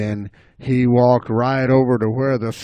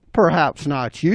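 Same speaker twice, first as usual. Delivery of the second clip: ordinary delivery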